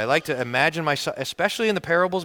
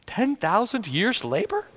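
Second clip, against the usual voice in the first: incredulous; 6 semitones higher, slower than usual. Acted. Disbelief at the amount.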